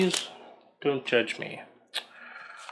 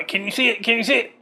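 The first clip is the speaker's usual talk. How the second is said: excited; 8 semitones higher, much faster than usual. Real, not acted. Excitedly showing the card artwork.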